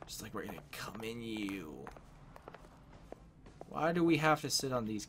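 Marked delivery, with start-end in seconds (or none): 0.1-1.7 whispered crude aside. Real, not acted. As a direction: drop to a whisper.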